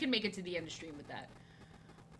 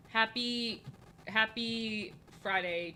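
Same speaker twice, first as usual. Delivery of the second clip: slow drawn-out; much slower than usual. Real, not acted.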